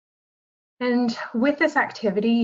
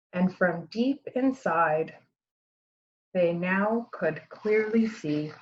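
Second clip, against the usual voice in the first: gentle storybook read-aloud with lively dialogue; reading to children. Acted. Teacher reads the picture book to her students, storytelling voice. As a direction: read it like story time for a class.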